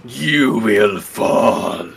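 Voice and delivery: villain voice. Pretend. Miyamoto villain voice.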